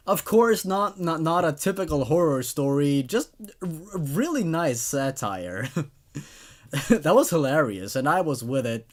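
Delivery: usual speaking voice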